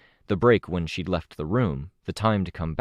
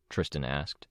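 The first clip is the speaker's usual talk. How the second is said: plain reading voice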